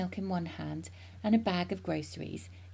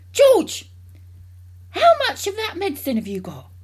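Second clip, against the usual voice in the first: loud, high call; excited demand; 12 semitones higher, 10 dB louder than usual. A narrator's reading. Mister Cranky questioning George about the medicine, excited and loud. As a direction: call the name loudly, then ask urgently.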